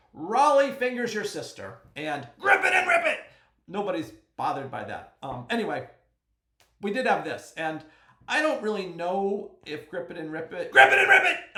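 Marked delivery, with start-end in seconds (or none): 0.1-1.8 louder, higher proclamation. Shown as a demonstration. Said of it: "to say out loud". 2.4-3.2 high, very loud team-name shout; sudden full-volume holler; yelling. Shown as a demonstration. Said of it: "I have to say it that way". 10.7-11.6 loud, high all-caps yell. Shown as a demonstration. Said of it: "do I need to yell it".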